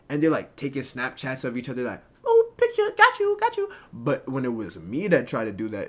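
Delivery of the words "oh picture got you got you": very high, loud mimicking squeal; mock them in a piercingly high falsetto; squealy mock voice. Mimicking giggly flirty photo taking.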